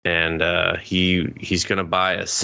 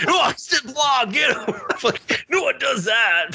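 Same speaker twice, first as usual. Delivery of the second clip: high-pitched mock shouting breaking into laughter; hammed-up squeal of indignation; mock outrage. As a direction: act out a ridiculous high-voiced tirade. Acted angry outburst, riffing on blog.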